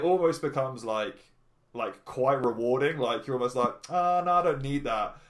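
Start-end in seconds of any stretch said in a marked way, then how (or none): 3.9-5.2 casual brush-off. Retold or imitated. Acted inner voice declining party.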